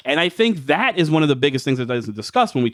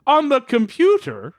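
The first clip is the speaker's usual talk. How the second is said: mocking old man; 8 semitones higher than usual. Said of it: "I just said".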